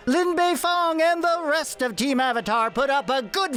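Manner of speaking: put-on old-time sportscaster. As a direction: call it like a twenties radio sportscaster. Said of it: "in the style of [name]'s sportscaster voice".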